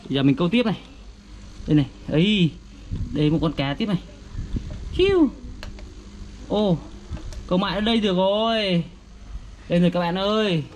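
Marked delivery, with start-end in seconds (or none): 5.0-5.3 high squeaky whoosh for the cast; mouth sound effect. Acted. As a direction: zip the line out with a high "chiu". Toy fishing, casting sound. (tiếng vút cao)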